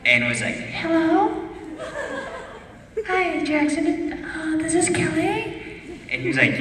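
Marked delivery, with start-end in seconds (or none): 0.5-5.6 put-on much higher, slow, breathy-sweet girly voice; fake girl voice. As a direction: play a shy girl on a late-night phone call. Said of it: "pretended he was a girl named Kelly".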